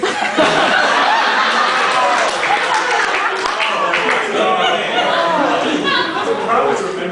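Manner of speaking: long high wheezing laugh that rises; helpless loud laugh; breathless laugh. Real, not acted. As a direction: crack up completely. Laughs at the ending.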